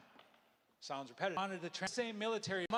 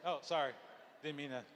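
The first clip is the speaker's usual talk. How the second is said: usual speaking voice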